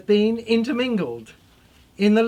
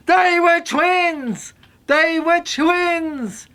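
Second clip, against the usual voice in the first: slow insistence; 4 semitones higher, much slower than usual. Acted. Insisting desperately on the truth.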